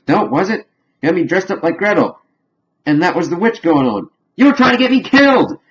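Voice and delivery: climbing from puzzled to a high shouted accusation; rising accusation. Retold or imitated. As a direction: build to an outraged yell at the end. Heidi accuses Cartman, rising anger.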